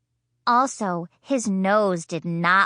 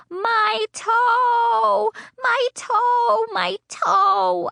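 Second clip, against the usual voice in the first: put-on high, loud child wail; hollering; 5 dB louder, much slower than usual. Girl wailing to Mother about her sore toe, loud and high. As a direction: howl it out like a hurt kid. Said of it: "I hollered real loud".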